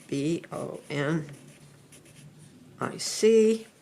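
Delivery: B O N I C spelled out, letter by letter; spelling it out. Shown as a demonstration. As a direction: spell out each letter of bubonic one by one.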